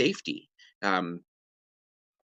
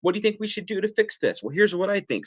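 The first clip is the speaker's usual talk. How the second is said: bright, much higher, bouncy questions; playful back and forth; 8 semitones higher than usual. Shown as a demonstration. Parent brainstorming with a calm kid, playful and lively. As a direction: toss ideas back like a beach ball. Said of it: "It's like a beach ball".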